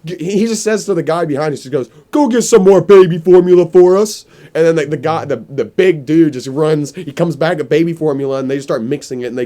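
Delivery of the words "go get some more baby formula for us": high, loud order to the big guy; loud command. Retold or imitated. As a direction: boss yelling at his underling. Boss ordering his man.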